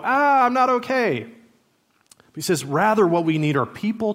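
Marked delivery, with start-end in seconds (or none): 0.0-1.3 high mock cry of a child; panicked kid. Kid copying a panicked parent, high mock wail. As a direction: imitate an alarmed kid, high-pitched. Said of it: "the kid who sees the parent".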